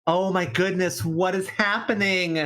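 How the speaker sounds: high, drawn-out amazement; astonished. Real, not acted. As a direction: stretch it out in disbelief. Commentator stunned by the play.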